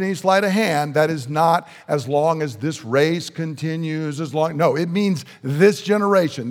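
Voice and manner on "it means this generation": "this" hit hard and falling; stress on this. Shown as a demonstration. Preacher insisting to the crowd on "this" generation. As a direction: lean on "this" to point at the listeners. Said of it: "the people I'm speaking to".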